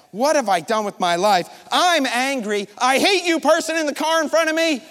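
retold road rage, building to a loud peak; angry inner rant. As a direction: vent at the driver ahead, high and loud. Acts out his inner anger in traffic.

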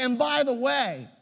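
preacher mocking the idea of leaving his daughter, breezy and absurd; mock casual. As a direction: play it as an absurd joke. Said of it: "It's ridiculous".

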